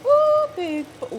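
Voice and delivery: very high, slow squeal; squeaky delight. Acted. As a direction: squeal at the butter.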